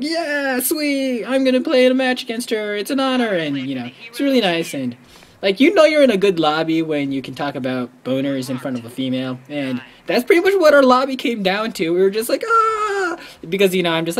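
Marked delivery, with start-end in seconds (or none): 0.0-3.3 higher, eager starstruck fan voice; eager fan reply. Retold or imitated. Fan telling the gamer girl it's an honor, higher and eager. 12.4-13.2 high fanboy squeal. Retold or imitated. Lobby squealing at the celebrity, high squeal.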